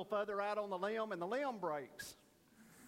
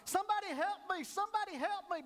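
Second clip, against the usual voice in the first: yelling for help; 10 semitones higher, faster than usual. Acted. Man in gorilla suit cries to the crowd, high desperate yell. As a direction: scream for help as the lion closes in. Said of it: "the gorilla yells out and says".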